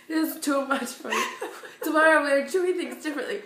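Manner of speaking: hammed-up complaint edged with laughter; imitated overwhelmed outburst. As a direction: act out a frazzled exit speech. Acts out stressed volunteer quitting.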